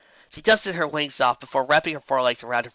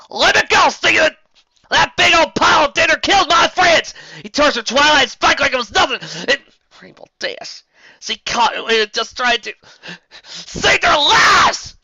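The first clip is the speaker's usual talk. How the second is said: much higher, loud angry twang shout; furious Applejack shout; 14 semitones higher, 10 dB louder than usual. A narrator's reading. Applejack to Steven, furious loud shout. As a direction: scream at him to let go.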